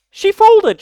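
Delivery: high loud outburst; exasperated yell. Real, not acted. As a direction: shout it in disbelief. Outraged at her apology.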